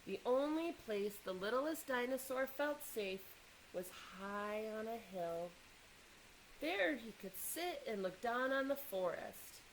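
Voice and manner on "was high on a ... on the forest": plain reading voice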